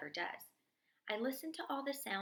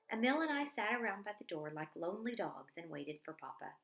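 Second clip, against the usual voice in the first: ordinary delivery, nothing marked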